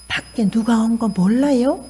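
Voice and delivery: tamed voice to husband. Retold or imitated. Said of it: "톤이 그 정도 내려가지 않으면". (아내가 남편에게 조용히 천천히 묻기)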